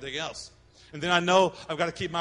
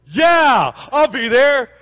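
mock-excited shout, much louder and higher; eager yes; 7 semitones higher, 12 dB louder, much slower than usual. Husband shouts yes to his buddy's golf invite, hammed-up. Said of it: "you get a text or the phone rings".

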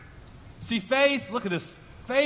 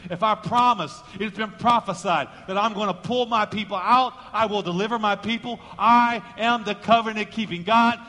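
full-force preaching; 2 semitones higher, 4 dB louder than usual. Acted. Preacher voicing God's promise to Moses, full-force preaching. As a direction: proclaim each phrase with weight.

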